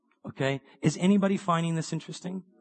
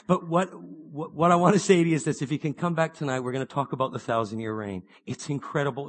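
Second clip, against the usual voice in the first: ordinary delivery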